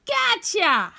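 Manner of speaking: a loud, high pounce of a shout; gleeful shout of a hiding dinosaur; dinosaur yelling. A narrator's reading. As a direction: snap the trap shut with a yell. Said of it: "a dinosaur yelling".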